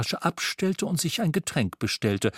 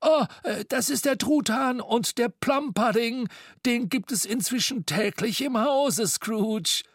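much higher, flustered excuse; a bit offended; 11 semitones higher than usual. A narrator's reading. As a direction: cover the hurt with chatter. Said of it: "ein wenig beleidigt".

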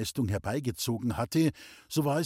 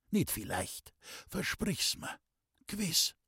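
soft, weak, urging whisper; whispered plea; 6 semitones higher, 6 dB quieter than usual. A narrator's reading. Sick man urging Pauli to promise, whispered plea. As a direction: press for a promise with little strength.

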